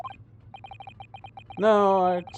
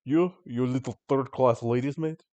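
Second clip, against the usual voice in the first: slow contemptuous Russian sailor; slow Russian-accented sneer; 4 semitones lower, slower than usual. Acted. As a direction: drag it out like a scornful Russian crewman.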